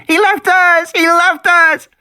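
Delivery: put-on high, loud child's scream of panic; screaming girl. Lex screaming at Tim that Ed abandoned them. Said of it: "she had started to scream".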